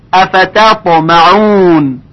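افتطمعون with a light ت before a heavy ط; slow careful recitation. Shown as a demonstration. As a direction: recite افتطمعون slowly, ت thin.

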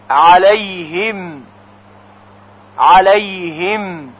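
صراط الذین انعمت علیهم said slowly, clear ṣad, dh and throaty ʿayn; careful Quranic pronunciation. Shown as a demonstration. Teacher drills verse words slowly for students. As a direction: drill each word twice, slowly and precisely. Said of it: "برای تلفظ".

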